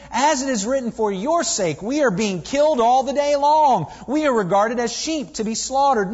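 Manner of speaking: reading scripture aloud with rising force. Acted. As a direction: proclaim the passage, building in fervour. Preacher reads Romans aloud.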